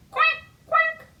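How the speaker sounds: high loud duck quack. Pretend. Librarian quacking for the toddlers. Animal sound in song.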